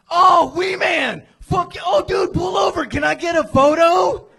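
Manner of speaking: a driver suddenly recognizing a celebrity; excited fan shout. Retold or imitated. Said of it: "And he goes".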